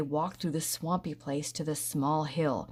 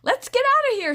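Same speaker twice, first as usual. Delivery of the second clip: very high, loud panic; panicked; 10 dB louder than usual. A narrator's reading. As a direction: cry out in alarm. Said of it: "said Rusty".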